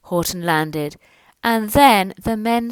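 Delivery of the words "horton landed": plain reading voice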